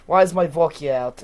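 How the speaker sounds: loud put-on Russian complaint; Russian accent. Dad asking about his vodka, in a mock Russian accent. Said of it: "he does not say vodka like that".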